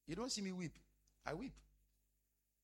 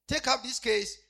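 retold order; 8 semitones higher, 13 dB louder, slower than usual. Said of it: "Then I said".

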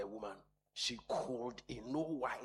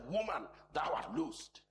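higher, louder commanding call; voicing Jesus; 6 semitones higher, 6 dB louder than usual. Jesus calling out to the bent woman, commanding. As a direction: proclaim it like Jesus releasing her. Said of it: "he called her to himself and said".

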